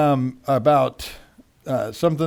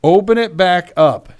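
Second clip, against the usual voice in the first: louder, punched-out read-aloud; emphatic exclamation; 7 dB louder than usual. Retold or imitated. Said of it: "Three exclamation marks".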